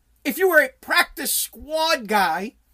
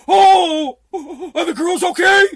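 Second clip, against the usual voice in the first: mock concern; 5 semitones higher, 8 dB louder than usual. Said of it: "the owner doesn't go".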